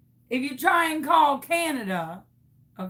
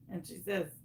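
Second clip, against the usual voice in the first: usual speaking voice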